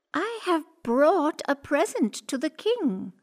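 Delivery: high, light cat voice. Acted. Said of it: "said Puss".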